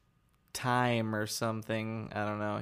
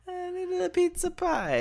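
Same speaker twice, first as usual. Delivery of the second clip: put-on very high, silly New York accent; squeaky cartoon Queens accent; Bronx accent; 3 dB louder than usual. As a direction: play a mock New Yorker, accent pitched way up. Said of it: "like, the Bronx accent or whatever".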